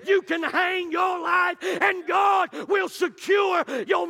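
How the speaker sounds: fiery preacher calls the church to get an attitude, shouting; preaching at full force. Acted. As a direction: build the sermon to a roaring climax. Preaching builds to a climax.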